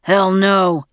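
loud, growled refusal; growling. A narrator's reading. Father refusing mother's plea for help, growling. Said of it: "growled Red".

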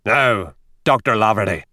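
a mildly gruff, menacing growl. A narrator's reading. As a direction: rumble it at the young doctor. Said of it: "growled O'Reilly".

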